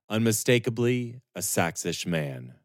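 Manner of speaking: plain reading voice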